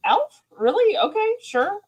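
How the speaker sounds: high, disbelieving "Alf? Really? Okay, sure."; a high squeaky mock reaction; incredulous mock quote. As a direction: play it as baffled disbelief. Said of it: "and I'm like".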